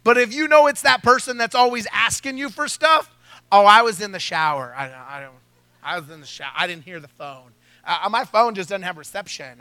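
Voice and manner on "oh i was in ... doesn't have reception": lightly voiced phony apology; mock excuse. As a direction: feign innocence on the phone. Faking excuse to caller.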